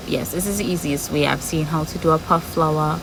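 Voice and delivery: normal talking voice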